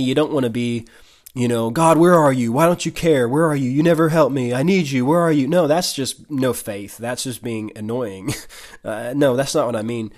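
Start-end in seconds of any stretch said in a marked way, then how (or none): 1.7-5.5 whiny pleading, pitched up and louder; mock whiny. Preacher mimics a believer complaining to God, mock whiny. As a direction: imitate someone pestering God with needy complaints. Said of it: "you don't wanna be whiny".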